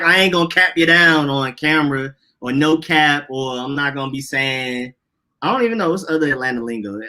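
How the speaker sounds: ordinary talk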